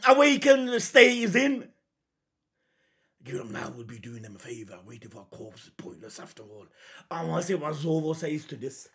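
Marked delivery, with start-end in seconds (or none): 3.2-6.7 much lower and fast, hammed-up villain; mock villain voice. Reactor parodying Lucci's taunt in a mock deep voice. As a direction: rattle it off in a mock-deep bad guy voice.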